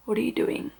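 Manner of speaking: soft, retold baffled question; hushed disbelief. Mother looking at husband in labour, hushed retold disbelief. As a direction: ask it quietly, imitating disbelief.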